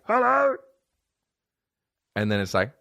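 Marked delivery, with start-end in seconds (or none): 0.1-0.5 very high mock phone greeting; prank-show caller. Prankster with a big cell phone, high mock hello. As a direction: blare it high like a silly prankster. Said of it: "stands up with a big cell phone".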